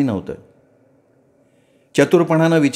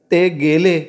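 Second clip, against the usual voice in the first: tearful; 5 semitones higher, slower than usual. A narrator's reading. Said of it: "तिचे डोळे डबडबलेले आहेत".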